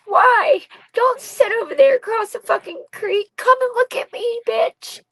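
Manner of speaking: high-pitched yell across a distance, re-enacted; retold anguished yelling. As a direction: call out demandingly, pitch climbing and staying high. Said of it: "And I'm yelling at them".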